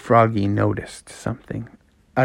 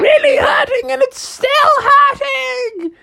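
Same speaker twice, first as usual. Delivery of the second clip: very high falsetto wailing; hammed-up cartoon agony; mom in pain; 4 dB louder than usual. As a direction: whine it up to a loud wail. Mom hurt after falling.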